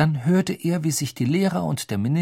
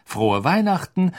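happy; 5 semitones higher, 3 dB louder than usual. Acted. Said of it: "sagte er glücklich".

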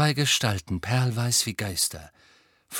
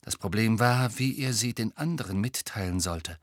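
ordinary delivery

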